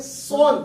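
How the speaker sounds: "سون" with a heavy emphatic s, teeth closed; full, rounded tajweed "سون"; thick heavy letter. Shown as a demonstration. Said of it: "دانت بند کر کے آواز موٹی".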